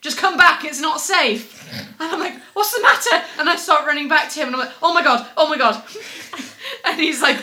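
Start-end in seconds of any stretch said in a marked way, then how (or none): 0.0-1.4 higher and louder, pleading warning; urgent plea. Retold or imitated. Man urging her away from the seagulls, loud plea. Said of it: "urgency in this man's voice". 2.5-3.2 high, loud, laughing worry; alarmed question. Retold or imitated. Woman running back to the worried man, alarmed. 4.8-5.8 mock panic. Panicked while running back.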